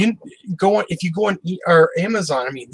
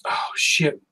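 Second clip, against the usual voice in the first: whispered; 4 dB quieter than usual. Real, not acted. Suddenly forgets company name.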